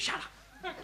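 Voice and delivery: hissed shut up. Acted. Hiding from wife, hushing.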